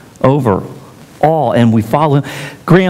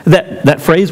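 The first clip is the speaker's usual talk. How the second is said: ordinary delivery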